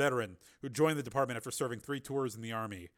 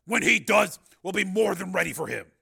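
higher, loud, confident boast; 7 semitones higher, 11 dB louder than usual. A narrator's reading. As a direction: declare that you are fully prepared. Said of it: "said the marshal confidently".

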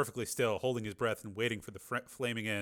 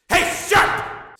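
much higher, louder scream; 13 dB louder than usual. A narrator's reading. Tommy screaming down at Sharp, full scream. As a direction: scream it across the arena. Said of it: "screamed Tommy".

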